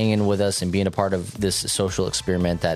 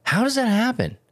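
high, incredulous question; high, amazed how does that happen; baffled inner thought; 14 semitones higher than usual. Retold or imitated. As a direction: ask it as if truly stumped. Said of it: "I think to myself".

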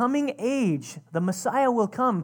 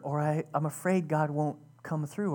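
ordinary talk

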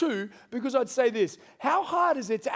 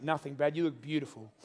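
mock reassurance; 6 semitones lower, 6 dB quieter than usual.

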